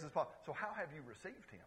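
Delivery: ordinary talk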